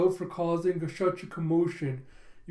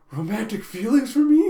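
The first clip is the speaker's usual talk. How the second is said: flustered hope; 3 semitones higher, 9 dB louder than usual. Retold or imitated. Flustered hopeful question.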